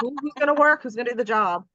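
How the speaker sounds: high, pushy boss voice; demanding boss. Retold or imitated. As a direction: bark like a boss wanting cover. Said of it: "other bosses tend to just".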